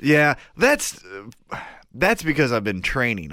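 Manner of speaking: hoarse, strained voice; talking through a sore throat; voice giving out. Real, not acted. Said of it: "Your voice is almost gone".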